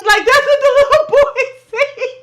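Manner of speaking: high, loud, cracking up; real laughter breaking into the words; laughing disbelief. Real, not acted. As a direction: squeal it through real laughter. Laughing at what the boy says.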